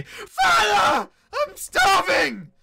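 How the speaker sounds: put-on starving young man screaming for his father, drawn out; desperate scream. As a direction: scream it out high and very loud. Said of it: "he just hears the voice scream out".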